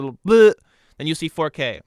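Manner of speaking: ordinary talk, nothing marked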